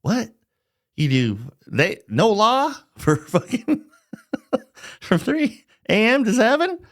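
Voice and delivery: baffled normal person. Retold or imitated. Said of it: "you're a normal person, and you're like".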